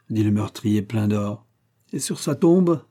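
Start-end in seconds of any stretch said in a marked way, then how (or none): 1.9-2.8 high-pitched sardonic cry; sardonic exclamation. A narrator's reading. Émile mocks the murderer at the banquet, sardonic. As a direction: exclaim it mockingly, pitched well up. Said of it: "s'écria Émile d'un ton sardonique".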